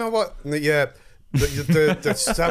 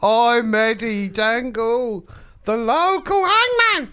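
high, slow London hangman voice; booming hammy Cockney hangman; London accent; 8 semitones higher, 3 dB louder, slower than usual. Acted. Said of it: "Oh, so I have to do a London accent?".

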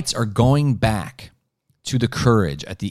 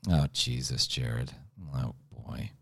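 very low, soft mumble to himself; muttered aside; 9 semitones lower, 12 dB quieter, slower than usual. Real, not acted. Host stuck finding the streamers, muttering low to himself. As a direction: trail down into a quiet, low grumble. Host fumbling for his notes.

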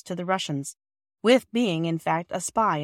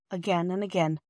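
ordinary delivery, nothing marked

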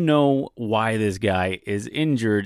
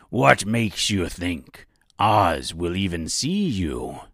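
slow, doubting pirate voice; pirate accent, dragged out; 4 semitones lower, slower than usual. Acted. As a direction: challenge them like a slow old-salt pirate. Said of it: "a bit of a pirate accent".

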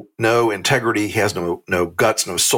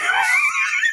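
shrieking laugh. Real, not acted.

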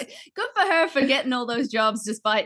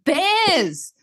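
high, emphatic retort landing hard on bears; mock incredulous outburst; 8 semitones higher, much slower than usual. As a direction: tell it like a comic protest, stretching out the horrors. Retold argument about scary animals.

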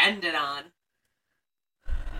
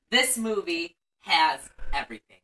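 ordinary delivery

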